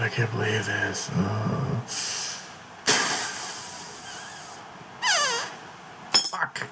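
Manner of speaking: a whispered curse of despair; defeated murmur. Real, not acted. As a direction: let the voice sink into defeat. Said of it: "Minor meltdown completed".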